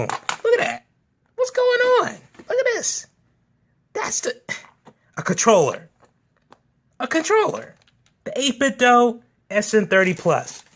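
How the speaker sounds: high-pitched cutesy squeal; squealing excitement. Real, not acted. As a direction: squeal over something tiny and cute. Gushing over tiny controllers.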